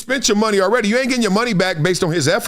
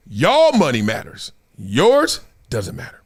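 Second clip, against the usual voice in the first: usual speaking voice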